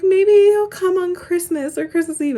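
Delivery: high, loud imitation of eager well-wishers; mock hopeful. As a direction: parrot their message in an exaggerated high voice. Mimicking people's unwanted hopeful messages.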